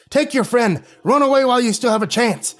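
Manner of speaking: high, loud desperate command; angry old man shouting. A narrator's reading. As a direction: shout them out of the cave.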